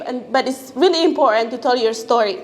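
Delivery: ordinary talk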